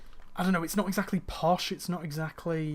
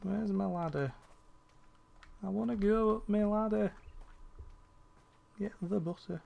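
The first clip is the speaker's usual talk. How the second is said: put-on game character in a northern accent, pitched up; northern English accent; 5 semitones higher than usual. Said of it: "Also all in a northern accent".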